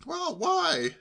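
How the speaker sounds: high-pitched nosy question; undeterred nephew. A narrator's reading. Nephew conceding the point but still asking, undeterred. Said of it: "conceding the point".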